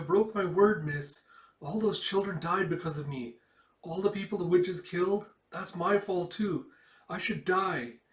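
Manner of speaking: put-on high, loud, anguished girl's voice; despairing girl. Girl blaming herself for the deaths, high anguished voice. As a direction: pour out the guilt, close to tears. Guilt-stricken girl confessing.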